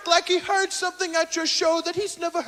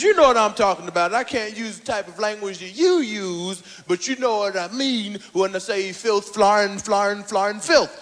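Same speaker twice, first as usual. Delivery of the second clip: lower, fast scolding; 8 semitones lower than usual. Retold or imitated. Cosby scolding.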